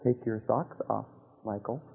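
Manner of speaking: God's gentle whisper to Michael on the dance floor, hushed; still small voice. Retold or imitated. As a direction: breathe it like a voice inside your head. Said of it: "a very still, small voice said".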